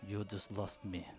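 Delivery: put-on low, hushed, slow accented mutter; whisper. Jasper privately scolding the failed performer, hushed. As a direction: lean in and speak low, drawn out. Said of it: "comes over and whispers".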